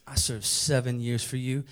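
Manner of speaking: low, soft lover's patter; smooth swagger. Acted. Jacob wooing his girl after seven years, smooth swagger. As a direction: woo her low and cool. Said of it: "he's got the swagger".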